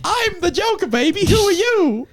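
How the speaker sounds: very high, hammed-up villain voice; Joker. Feeding a Harley impression its cue, high mock villain. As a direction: play a squeaky mock villain. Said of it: "what would the Joker say to Harley".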